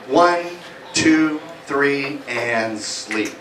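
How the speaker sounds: low, soft, slowing hypnotist voice; hypnotic sleep cue. Acted. Hypnotist lulling volunteers into sleep, low and soft. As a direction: sink them down into trance.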